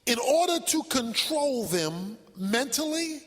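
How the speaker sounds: soft teaching read. Acted.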